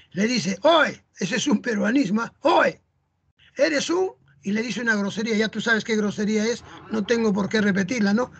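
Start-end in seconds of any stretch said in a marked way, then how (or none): 0.6-1.0 louder, higher mock hail; calling out. Peruvian lad hailing his friend, an acted call. 2.4-4.1 calling out. Acted. Peruvian lad starting to insult his friend, acted call.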